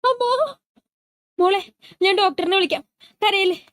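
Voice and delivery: high, frantic pleading; a mother in panic, trying to comfort; panicked mother. Acted. Mother panics over daughter's pain.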